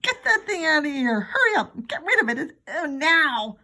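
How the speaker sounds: high, loud panicked scream. Acted. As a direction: shriek it in horror. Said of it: "but Mom screamed".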